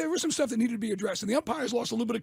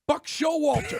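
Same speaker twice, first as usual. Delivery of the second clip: louder, higher, stretched Buck Showalter shouted; mocking shout of the name; 4 semitones higher, 3 dB louder, slower than usual. Shown as a demonstration. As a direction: bellow Showalter as if mocking the manager. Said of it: "Why you gotta say it like that?".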